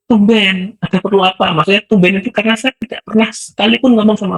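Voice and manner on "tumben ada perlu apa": high surprised woman's voice; a startled mother asks why he came. Retold or imitated. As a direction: voice the neighbour caught off guard.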